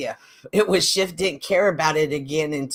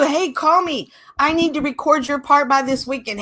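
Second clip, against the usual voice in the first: higher, louder mock call to a partner; pretend phone request; 7 semitones higher, 3 dB louder than usual. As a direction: act out the ideal message, bright and pushy. Voicing what the partner should've said.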